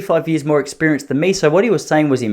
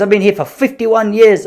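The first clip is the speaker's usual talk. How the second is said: higher, louder indignant retort; mock offended vendor; 7 semitones higher, 4 dB louder than usual. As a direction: play the vendor taking offence. Vendor answering proudly.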